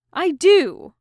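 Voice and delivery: a loud, high group shout; big joyful yell of "I do". A narrator's reading. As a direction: yell it out with eager agreement. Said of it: "they yelled".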